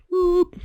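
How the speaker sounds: high, loud siren whoop; a short piercing "whoop" as the siren; imitated siren. Storyteller punctuating the bronze people's question, high loud siren whoop. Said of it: "what's the siren mean".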